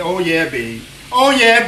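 ordinary talk

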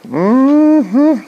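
high, loud drawn-out rising hum; mock-confident hum; smug mm-hmm. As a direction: answer with a smug singsong hum. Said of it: "I'm like".

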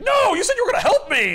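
high, loud, fast mock outrage; hammed-up indignant yell; mad protest. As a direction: shout it like a betrayed friend. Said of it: "And Doom is mad about it".

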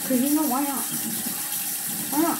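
toothbrush in mouth; talking while brushing teeth. Real, not acted. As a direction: mouth full of toothpaste foam.

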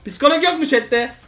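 loud, high mock plea to chat; begging chat for a psychologist; desperate call. Real, not acted. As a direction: cry out for help, half joking.

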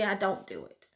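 ordinary talk, nothing marked

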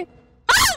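sudden high shriek, loud; jump-scare yelp; startled scream. Real, not acted. Gamer caught off guard in the game, shrieking.

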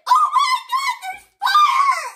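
very high, full-volume panicked shriek; re-enacted scream. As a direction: scream it out as if discovering danger. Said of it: "I literally screamed it".

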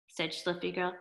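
usual speaking voice

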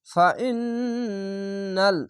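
ফাইন্নাল very slow, the ন্ন hummed through the nose; nasal ghunna. Shown as a demonstration. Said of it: "এখানে ওয়াজিব গন্না".

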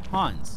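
Hans with an open "ah", like "hahns"; German-style "Hahns" for Hans; German pronunciation. Shown as a demonstration. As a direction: say Hans the German way, rhyming with "bonds". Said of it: "same name pronounced different ways".

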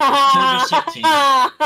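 slow, high theatrical announcement; slow, high and loud title reading; dramatic date card. Acted. Narrator reads date dramatically.

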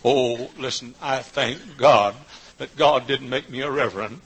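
ordinary talk

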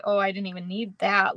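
ordinary talk

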